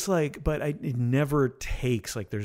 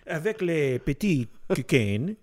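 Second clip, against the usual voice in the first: higher-pitched put-on French accent; mock French accent; 5 semitones higher, 4 dB louder than usual. Co-host milking the French joke, mock French accent. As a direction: play up a silly French accent. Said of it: "Maybe it's a French thing".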